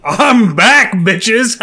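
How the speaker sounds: loud, high, gleeful cheer with a laugh; triumphant comeback shout. Real, not acted. Guest greets the podcast crew on his return, triumphant and loud. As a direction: burst back in like a returning champion.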